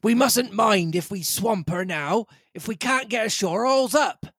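high, rising urgent captain voice; captain's voice. Acted. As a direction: push the men like a desperate captain. Captain urging rowers on.

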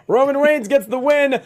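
high, slowed words through laughter; laughing announcement. Real, not acted. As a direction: push the result out while cracking up. Resumes recap while still cracking up.

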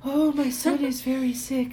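high, meek and mopey; mock sad meek voice. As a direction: whimper it like a sad little mouse. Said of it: "she sounds like she's sad the whole time 'cause she's so quiet".

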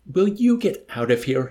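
high, loud desperate plea; beseeching yelp. A narrator's reading. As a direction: yelp it imploringly. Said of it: "he yelped beseechingly".

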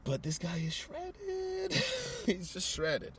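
awed. Real, not acted. Host gushing about the fighter's physique, slow and awed. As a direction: marvel at how ripped he is. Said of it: "awed about fighter's physique".

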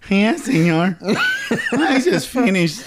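cartoon Mexican accent, laughing through it. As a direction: put on a hammy cartoon Mexican voice. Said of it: "That's my favorite Mexican accent".

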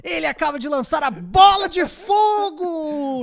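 loud, high excited play-by-play; esports caster. Retold or imitated. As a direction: call the fireball like a caster. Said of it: "Tipo assim". (narrador de esports empolgado)

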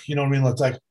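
ordinary delivery

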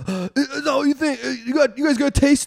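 high, halting, hammed-up urging; mock excited discovery. First milk drinker urging others to taste, mock excited discovery. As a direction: gasp out a discovery to friends.

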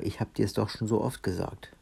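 usual speaking voice

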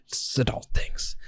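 slow whispered aside; whispered grown-up complaint. Real, not acted. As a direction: breathe it out in a whisper.

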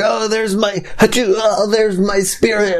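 high-pitched hammed-up cry for help; mock panic. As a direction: pretend to panic as your soul escapes. Acts out losing soul while sneezing.

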